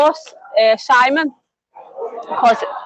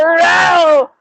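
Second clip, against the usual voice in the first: higher, stretched "row" noise; imitated cry; 4 semitones higher, 3 dB louder than usual. Old Spanish lady reacting to a tattoo, imitated higher cry. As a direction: mimic her wordless exclamation. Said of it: "she goes".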